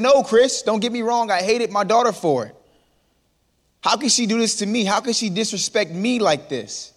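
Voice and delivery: acted-out hurt outrage; retold indignation. Acting out man's hurt outrage.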